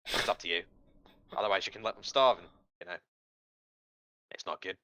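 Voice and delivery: put-on imp with a wobbly Australian accent; sly devil in a shaky Australian accent. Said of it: "Did the guy start off English and then end up Australian?".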